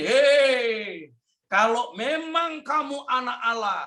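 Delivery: high, sneering tempter's voice; mock him like the devil; taunting devil. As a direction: devil taunting Jesus in the desert. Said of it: "iblis itu ganggunya begini".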